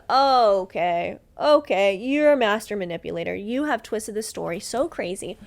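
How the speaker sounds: mock-calm okay turning into a loud accusation; sarcastic okay, then call him a master manipulator, loudly. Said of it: "I said".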